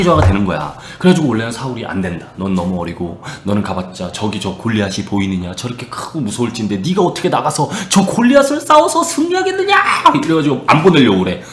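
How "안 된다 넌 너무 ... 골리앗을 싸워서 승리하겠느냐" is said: doubting king. Retold or imitated. Saul refuses the young shepherd.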